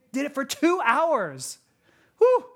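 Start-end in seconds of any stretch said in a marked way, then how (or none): none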